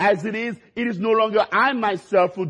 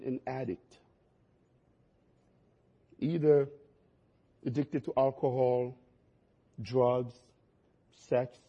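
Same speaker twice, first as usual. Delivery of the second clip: ordinary talk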